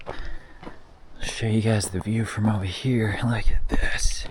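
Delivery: hushed, slow whisper at the view. Real, not acted. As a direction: breathe the words in awe. Shows the view in awe.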